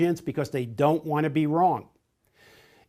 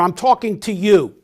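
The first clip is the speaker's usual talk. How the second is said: louder, pointed and insistent; forceful demo; 4 semitones higher, 8 dB louder than usual. Shown as a demonstration. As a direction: demo of a direct type driving a point home, loud and emphatic. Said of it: "use gestures and vocal intonation to emphasize".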